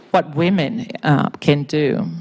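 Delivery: ordinary talk, nothing marked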